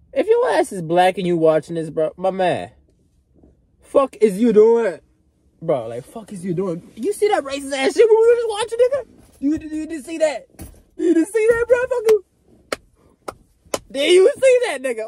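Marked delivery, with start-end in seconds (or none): 3.8-5.9 slow, loud, disbelieving; louder, slower challenge to the viewer; scolding. Acted. 7.0-15.0 shrill mock outrage at the viewer; incredulous rant. Ranting at viewer about clip.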